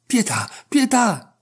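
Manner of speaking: slow, high, trembling plea for mercy; cowering barber begs on the couch, moaning in fear. A narrator's reading. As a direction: beg for mercy, terrified. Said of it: "gemeva quello ... tutto tremante".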